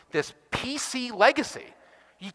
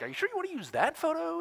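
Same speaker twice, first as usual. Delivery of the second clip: doubtful colleague; 10 semitones higher, 7 dB quieter than usual. Retold or imitated. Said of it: "my colleague Travis over here is like".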